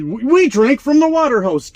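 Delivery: mock Gen X boasting. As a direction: brag like it was a war story, hammed up.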